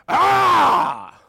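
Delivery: loud high open-throated roar; sudden screaming roar; demon's roar. Acted. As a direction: roar it out full force. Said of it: "gets up and just roars".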